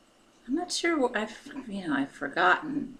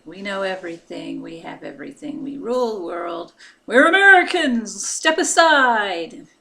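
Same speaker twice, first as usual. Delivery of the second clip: arrogant American; 7 dB louder than usual. Retold or imitated. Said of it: "coming across as arrogant".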